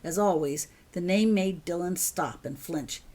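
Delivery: usual speaking voice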